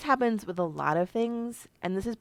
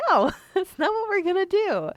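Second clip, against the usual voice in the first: much higher and louder, laughing no; laughing refusal; 13 semitones higher, 9 dB louder than usual. Retold or imitated. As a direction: push back playfully, laughing. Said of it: "I'm like".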